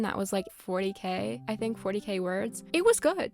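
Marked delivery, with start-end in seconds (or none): 2.7-3.3 high, loud burst of praise; excited. Real, not acted. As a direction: blurt it out, thrilled. Gushing about the fan fiction.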